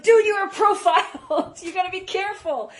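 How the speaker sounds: high, loud, half-laughing mock alarm; laughing warning. Telling her friend he got profiled, laughing alarm. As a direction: warn him while cracking up. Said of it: "I was like".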